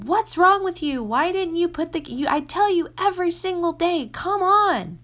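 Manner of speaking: high, loud exasperated mother; mock angry yell at a son. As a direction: snap at the child in frustration. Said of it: "in anger and yelling".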